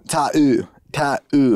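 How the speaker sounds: "ta-ooh" with a hard drawn ooh; mock Australian "ta-ooh". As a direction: split "ta-ooh" and lean on the ooh. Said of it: "hitting with a hard ooh".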